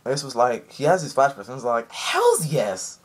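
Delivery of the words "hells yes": mock cheer in a changed voice; excited yell. Ace answering Whitebeard with a mock cheer. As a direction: punch the air with it.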